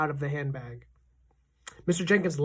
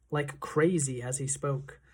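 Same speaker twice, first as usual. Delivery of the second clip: ordinary talk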